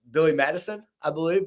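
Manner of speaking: ordinary talk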